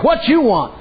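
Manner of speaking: high, curt mock challenge; gruff lady. Lady turning around on the preacher, mock curt. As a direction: throw it at him suspiciously.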